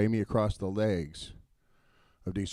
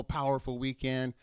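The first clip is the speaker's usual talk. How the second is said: ordinary delivery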